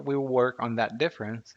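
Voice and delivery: normal talking voice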